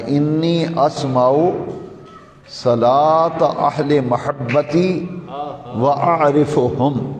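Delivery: slow, drawn-out Arabic recitation; hadith recitation. Real, not acted. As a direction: stretch the long vowels, measured and deliberate.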